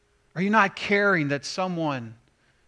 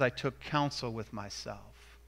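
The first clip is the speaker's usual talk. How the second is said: softer, lower, thoughtful I took counsel with myself; quiet and reflective; 5 semitones lower, 8 dB quieter than usual. Shown as a demonstration. Preacher explaining Nehemiah's restraint, quiet and reflective. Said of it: "he took some time to think about it".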